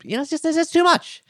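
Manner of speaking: high-pitched, overwhelmed blurt; hammed-up cry of overload; exasperated outburst. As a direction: burst out high, as if swamped by it all. Mock overwhelm at modern beeping.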